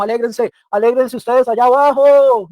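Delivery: loud, high call down from far away; shouting from afar. Retold or imitated. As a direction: yell down to people far below. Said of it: "El cielo no nos grita desde lejos".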